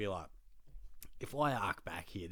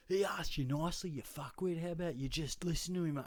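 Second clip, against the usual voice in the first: high-pitched mock aggro mate; imitated telling-off; 7 semitones higher than usual. Bloke's mate backing him up over the kicked seat, mock aggressive. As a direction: mimic a footy bloke butting in.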